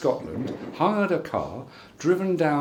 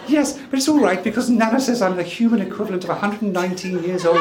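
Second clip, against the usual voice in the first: Pretty the dog's voice; 7 semitones higher, faster than usual. Retold or imitated. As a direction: squeak it out as the little dog Pretty. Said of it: "She says".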